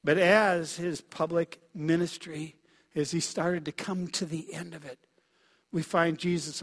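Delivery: normal talking voice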